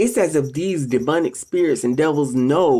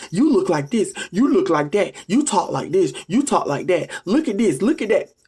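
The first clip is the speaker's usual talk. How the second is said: higher, taunting singsong of the insults; mocking troll insults; 6 semitones higher than usual. To the audience, mimicking trolls mocking her looks in higher taunting voice. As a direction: mimic a petty troll jeering.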